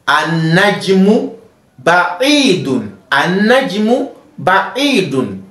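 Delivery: "an-najmu ba'idun" slow and drawn out, each syllable clear; slow model reading. Shown as a demonstration. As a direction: read it slowly for the class to repeat. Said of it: "let's focus on pronunciation of these words".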